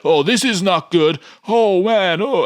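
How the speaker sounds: high, loud panicky movie-extra voice; cliche action extra. Retold or imitated. Extra in the armored truck frets, in high hammed-up panic. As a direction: ham up a worried guy in a truck. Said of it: "stupid action cliche lines".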